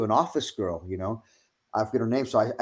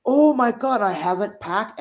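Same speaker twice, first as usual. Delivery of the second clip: high, frantic oh my God; panicked; 9 semitones higher than usual. Retold or imitated. Overslept and panicking about the flight, high frantic cry. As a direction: panic on realising you overslept. Waking late, missed alarm panic.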